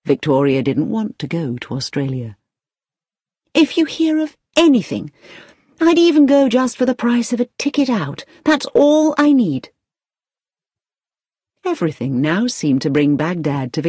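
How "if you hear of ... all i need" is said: high-pitched, insistent job seeker; eager plea. A narrator's reading. Victoria presses the agency manager, eager. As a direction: plead for any chance, pitch well up.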